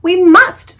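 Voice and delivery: high, loud insistent cry; cried. Acted. Sophie begs the BFG, loud and high. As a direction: insist with all her might. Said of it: "Sophie cried".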